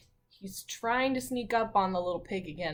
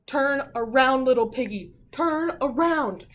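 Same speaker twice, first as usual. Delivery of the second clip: louder, urgent turn around; calling a warning; 3 semitones higher, 8 dB louder than usual. Pretend. Teacher calling to the little pig to turn around, louder. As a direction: warn the pig from the audience.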